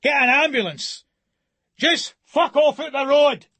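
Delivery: high, loud raging command; furious yell. Acted. Man yelling at gawking crowd to fetch an ambulance, furious. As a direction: bark orders at the useless crowd.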